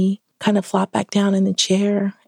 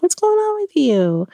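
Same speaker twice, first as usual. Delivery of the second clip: much higher, puzzled wife asking husband; concerned question; 12 semitones higher than usual. Retold or imitated. Wife asks agitated husband what's wrong, high concerned question. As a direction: lift it up high in worried surprise. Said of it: "I was like".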